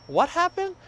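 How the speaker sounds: sudden high-pitched what happened; surprised disbelief. Retold or imitated. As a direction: voice jumps up in disbelief. Retelling his shock at the news.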